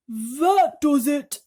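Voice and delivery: very loud, high, slow cry. A narrator's reading. Earthworm crying out to the others in loud despair. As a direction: burst out in despair. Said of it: "cried the earthworm".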